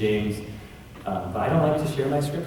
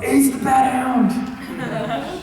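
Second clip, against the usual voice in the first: high, loud, drawn-out battle cry; hammed-up comic-book proclamation; mock heroic shout; 14 semitones higher, 5 dB louder, much slower than usual. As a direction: shout it slowly like a superhero's big entrance. Mock announcing the comic's cover character.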